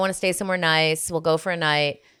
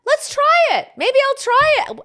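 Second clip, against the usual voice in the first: excited; 6 dB louder than usual. Pretend. Mock-enthusiastic.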